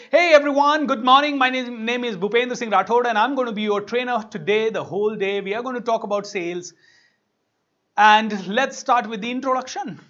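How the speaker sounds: booming trainer welcome to the room; loud greeting. Retold or imitated. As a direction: project it to a full classroom. Trainer greeting class loudly.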